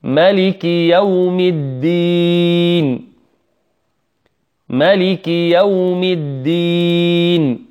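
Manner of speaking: slow chanted verse for repeat-after-me; measured tajweed chant; model recitation. Shown as a demonstration. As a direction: recite slowly with the last vowel drawn out. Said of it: "أقرأ الآن وتعيدون معي".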